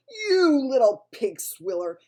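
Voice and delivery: loud, slow furious giant roar. A narrator's reading. Bloodbottler attacks the BFG, roaring with fury. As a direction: bellow with rage.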